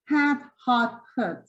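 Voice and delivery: "Hat" spread, "hot" open, the last "hot" lax; three vowel demo. Shown as a demonstration. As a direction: model the three vowels for teachers. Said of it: "So if I say it".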